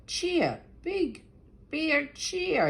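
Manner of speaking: high bright call of cheer; cheery call. Acted. Bear mishearing the tree, a high cheerful call. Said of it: "He thought Tree said".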